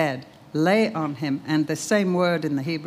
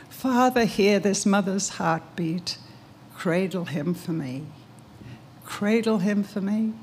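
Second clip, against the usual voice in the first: poem recital; 2 semitones higher than usual. Real, not acted. Mother's poem recited to her son.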